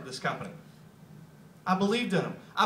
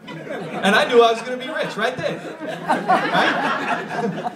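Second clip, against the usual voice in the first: loud, laughing punchline; 3 semitones higher, 7 dB louder, slower than usual. Real, not acted. Speaker jokes to audience, loud and laughing. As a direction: laugh through the joke about getting rich. Laughing at his own joke.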